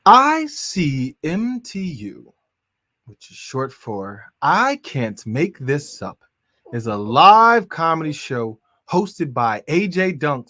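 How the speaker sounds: loud, drawn-out hype announcer read; announcer intro. Acted. Announcer opening the comedy show, booming hype read. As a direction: sell the show like a ring announcer.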